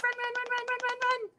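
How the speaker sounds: high, fast run chant; excited. Shown as a demonstration. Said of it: "run really fast".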